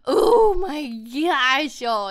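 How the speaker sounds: loud, very slow delighted gasp; excited gush. Real, not acted. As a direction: stretch it out in awe. Gasps at rainbow ombre hair.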